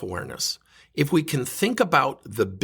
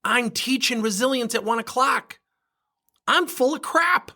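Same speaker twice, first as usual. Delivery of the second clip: sudden realization; 10 semitones higher than usual. Retold or imitated. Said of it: "I thought".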